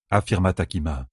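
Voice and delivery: plain reading voice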